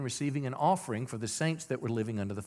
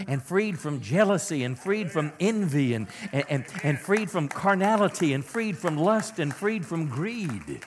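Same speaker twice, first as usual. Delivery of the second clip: loud, rising preacher build; preaching at full force; 3 semitones higher, 4 dB louder than usual. Real, not acted. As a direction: preach it harder each line.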